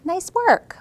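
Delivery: bright high praise; excited teacher praise. Real, not acted. Praising a child.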